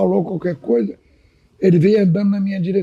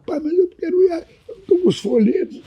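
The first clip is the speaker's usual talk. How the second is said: high, crying plea to his father; desperate pleading; 10 semitones higher than usual. Retold or imitated. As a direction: beg through tears, voice breaking up. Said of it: "Aí eu fiquei desesperado". (súplica chorosa, quase rindo, com a voz aguda)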